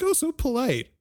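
mock the enemy's fake courtesy; dainty, over-sweet mock reply; mock polite.

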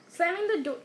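ordinary delivery, nothing marked

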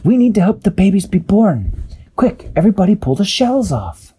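high, urgent character voice; Peso's urgent voice. A narrator's reading. Peso urging crew to free babies, high urgent call. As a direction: lift up and hurry them. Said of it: "Peso realized".

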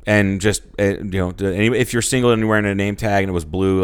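normal talking voice

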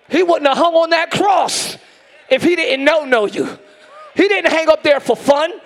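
full-force preaching. Acted. Preacher to church about the nails and the cross, preaching hard. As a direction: drive each phrase home to the congregation.

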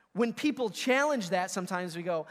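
usual speaking voice

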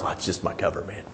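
hushed undercover aside; conspiratorial whisper. Retold or imitated. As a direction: breathe it out like a secret. Retold excuse, whispered again.

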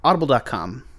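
measured, projected podcast ad delivery; sponsor ad read. Real, not acted. Podcast host to listeners about the sponsor, ad read.

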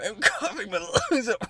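really coughing from laughing; winded from laughing too hard; breathless laughter. Real, not acted. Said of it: "I'm laughing".

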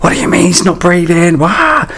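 high, shrill retold panic ending in a wail; panicked owner screaming at her husband; hysterical panic. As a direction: relive the hysterical scream of fear. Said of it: "so I was hysterical".

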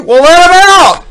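high, very loud prank-caller yell; shouted punchline. Acted. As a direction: yell the punchline at full tilt. Prank-call joke punchline, shouted.

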